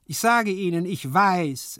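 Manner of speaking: high, loud servant voice; insistent servant. A narrator's reading. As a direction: insist that you know. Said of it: "erwiderte der Diener". (beharrlicher Diener, hoch und laut)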